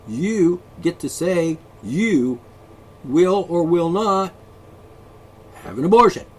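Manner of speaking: slow, loud and deliberate; slow emphasis. Real, not acted. Host spells out freedom to his opponents slowly. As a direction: lay out each word slowly.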